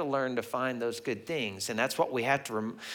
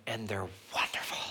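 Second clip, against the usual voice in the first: mock-reverent whisper, voice dropping away; hushed gushing; 3 semitones lower, 7 dB quieter than usual. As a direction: gush it in an awed whisper. Grandfather gushing about his grandchildren.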